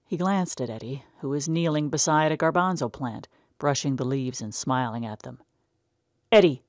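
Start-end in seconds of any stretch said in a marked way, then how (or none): 6.3-6.7 sharp, loud call of his name. A narrator's reading. Older brother calling sharply to dawdling Eddie. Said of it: "Tom said sharply".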